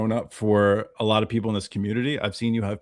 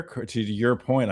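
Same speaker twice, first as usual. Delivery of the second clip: ordinary delivery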